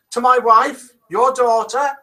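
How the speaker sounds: high, hammed-up Irish accent sliding into Welsh; worked-up Irish-Welsh lilt, pitched high; attempted Irish accent gone Welsh. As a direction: rage in a bad Irish accent. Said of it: "He's gone Welsh now".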